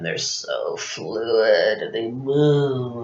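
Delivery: drawn-out, quiet, gliding like a snail; slow and fluid. Acted. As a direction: stretch the words out very slowly. Acting out snails' fluid movement.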